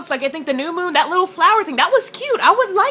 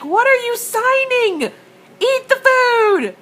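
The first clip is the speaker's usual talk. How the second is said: exasperated; 10 semitones higher, slower than usual. Retold or imitated. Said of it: "I'm like".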